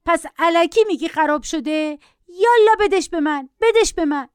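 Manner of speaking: lightly voiced spoilt boy grabbing; demanding child. A narrator's reading. Boy demands the tablet from mother, lightly voiced shriek. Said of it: "جیغ پسر بچه بالاتر رفت".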